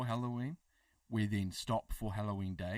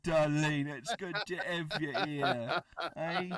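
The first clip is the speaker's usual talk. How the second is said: excited welcome; 6 semitones higher, 8 dB louder than usual. Real, not acted. Loud excited welcome to guest.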